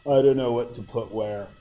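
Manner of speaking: low, quiet mumble imitating students; mock helpless student voice; complaining student. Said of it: "You guys will tell me".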